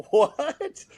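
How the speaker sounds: high, stunned disbelief; shocked what. Retold or imitated. Hearing the famous name from his friend, high stunned disbelief. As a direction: squeak it out, floored by the news.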